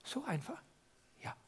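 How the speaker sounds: unvoiced whispered question. Real, not acted. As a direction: whisper the doubt aloud. Preacher whispers a doubting aside. (geflüsterte Zweifelsfrage)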